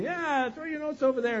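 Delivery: high, drawn call to a distant boat. Acted. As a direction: call across the water from the shore. Jesus calling from the shore.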